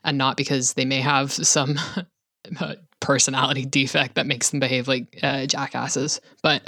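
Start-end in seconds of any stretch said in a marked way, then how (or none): none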